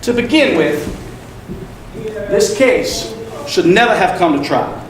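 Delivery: loud, deliberate courtroom address; Atticus Finch, forceful. Acted. Lawyer defending a wrongly accused man, forceful. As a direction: project firmly, pausing for weight.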